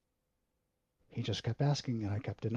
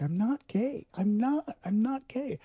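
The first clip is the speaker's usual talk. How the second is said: much higher, louder, tearful pleading; crying denial; 11 semitones higher, 7 dB louder than usual. Retold or imitated. Son crying his denial to his questioning dad. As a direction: beg through the start of tears. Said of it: "I just started crying".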